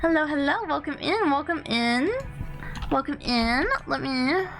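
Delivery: high, bubbly ghost conductor greeting; cute anime streamer. Acted. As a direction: welcome raiders in a sing-song high voice. Greeting raiders as character.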